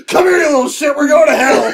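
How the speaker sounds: mock-menacing taunt as he drags you down; gleeful villain grab. Retelling the Ganon kick, hamming a gleeful villain. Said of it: "he's just like".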